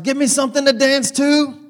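imitating Angela. Angela telling the worship band to play, loud high imitation. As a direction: call out to the band like an excited church lady. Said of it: "And she starts".

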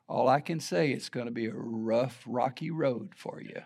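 a hint of a quiet, frail old lady; lightly voiced old woman warning him; soft, low-key old lady's warning.

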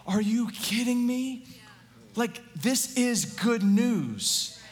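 mock stunned believer; jaw-dropped awe. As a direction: gasp it out in wonder. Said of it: "with our jaws open going".

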